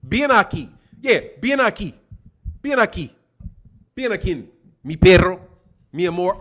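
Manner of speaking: mock Spanish accent, coaxing the dog; lightly put-on Spanish accent talking to the dog. Calling the dog in a Spanish accent, coaxing. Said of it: "when we move".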